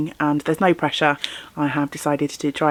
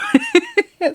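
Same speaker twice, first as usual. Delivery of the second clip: self-deprecating laugh. Real, not acted. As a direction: giggle high at your own expense. Laughs about her rusty French.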